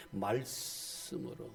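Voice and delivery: soft slow answer. Shown as a demonstration. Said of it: "뭘로 깨끗하게 하느냐?".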